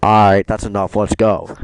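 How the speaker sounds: loud, firm order to leave; stern call. Retold or imitated. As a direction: bark it out to move along. Hank retold, impatient.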